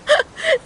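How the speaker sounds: a short, high, pleased giggle; giggle, flattered; bright laugh. Acted. Niece laughs at the compliment.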